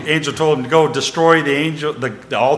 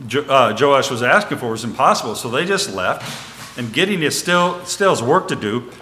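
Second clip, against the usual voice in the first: ordinary delivery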